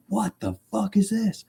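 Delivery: high, squeaky disbelief at the fire truck; mock a stunned driver, pitch way up; shocked outburst. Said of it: "the woman behind him was just like".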